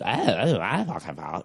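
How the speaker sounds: garbled, wavering mimicry of excuses; mock a blame-dodger with mushy babble; mocking whiner. Mimics people dodging blame.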